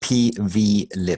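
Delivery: "P V lib" slowly, each letter distinct; "P V lib" spelled out slowly, V clearly voiced; clear correction. Shown as a demonstration. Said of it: "P, P B lib?".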